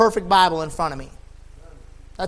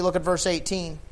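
normal talking voice

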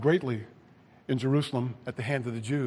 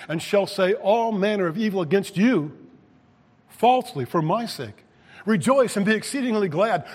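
loud, raised scripture proclamation; forceful preaching; 5 semitones higher, 7 dB louder than usual. Acted. Preacher quotes Jesus on reward for the persecuted, forceful preaching. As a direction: proclaim it to the whole congregation, building in force.

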